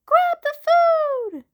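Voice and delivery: high, loud urgent mom yell; shouting mom. Acted. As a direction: yell over the pouring rain. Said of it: "shouted Mom".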